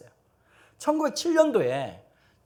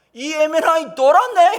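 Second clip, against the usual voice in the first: high, loud, drawn-out indignant yell; mock angry outburst; 8 semitones higher, 11 dB louder than usual. Shaman swearing at the missionary, mock furious yell. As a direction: act out an outraged shaman cursing.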